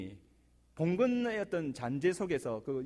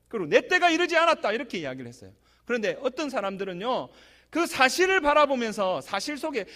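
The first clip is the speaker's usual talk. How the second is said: louder, higher exhortation from the pulpit; full force preaching; 7 semitones higher, 6 dB louder than usual. Acted. Pastor urging the congregation not to stumble, fervent. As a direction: press the point on the congregation.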